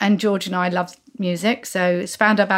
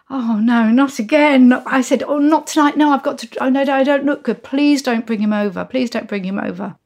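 high, urgent retold protest; plead, starting high and easing down; flustered pleading; 4 semitones higher, 3 dB louder than usual. Begging friend not to bring crush over, mock flustered.

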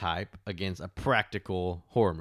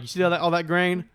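louder, higher lecturing; exasperated loud point; 8 semitones higher, 6 dB louder than usual. Retold or imitated. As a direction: hammer the point home. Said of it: "I'm like".

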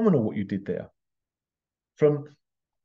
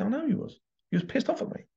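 teasing, much higher, a little softer; 11 semitones higher, 4 dB quieter, faster than usual. Retold or imitated. Mum teasing her daughter in a high playful voice. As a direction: sing it up high to tease her. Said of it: "I said".

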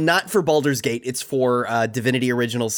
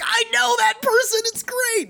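very high-pitched mock fan squeal; excited recognition.

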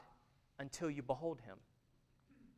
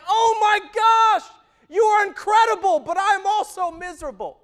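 overwhelmed Isaiah; 18 dB louder than usual. Acted. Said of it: "he's like".

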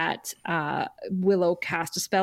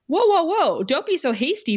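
raised, high-pitched "hold on"; mock urgent protest; 7 semitones higher than usual. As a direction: jump in to halt a hasty decision. Retold urgent plea to Buffy.

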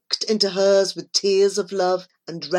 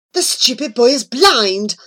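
loud, raised angry outburst; furious screech; 4 semitones higher, 6 dB louder than usual. A narrator's reading. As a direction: spit it out in fury. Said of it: "the angel gave a terrible screech".

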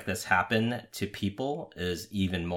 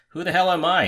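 high, loud, lightly voiced Russian New Yorker; a touch of Russian New York accent; 10 semitones higher, 6 dB louder than usual. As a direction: imitate an indignant Russian-born New Yorker. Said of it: "in his best Russian New Yorker accent".

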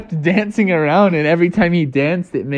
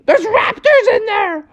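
very high squeaky yelp; high-pitched cartoon alarm; panicked baby possum. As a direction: squeal a warning as a baby animal. Baby possum keeps yelling its warning.

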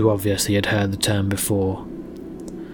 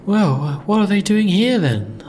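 higher, uneasy question; uneasy young woman; 10 semitones higher, 3 dB louder than usual. Acted. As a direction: ask it nervously, high. Mara asking in character.

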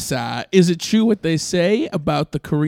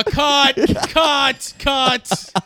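director calling cut; 7 semitones higher, much slower than usual. Acted. Director stopping the scene.

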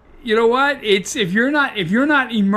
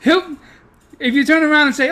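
normal talking voice

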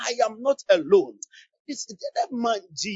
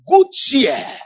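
preaching; 5 semitones higher, 10 dB louder than usual. Real, not acted. Congregation call and response.

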